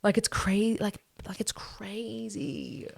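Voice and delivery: trailing off into a whisper; fading to a whisper. Real, not acted. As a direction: drop from normal to a hushed whisper.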